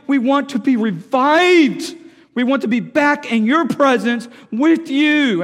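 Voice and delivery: loud, high corporate prayer; impassioned cry of repentance. Acted. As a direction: plead to God with rising fervour. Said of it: "they're crying out".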